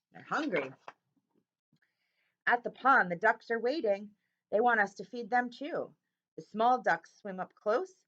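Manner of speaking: lively storybook read-aloud; bright picture-book narration; reading to children. A narrator's reading. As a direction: read it like story time for kids.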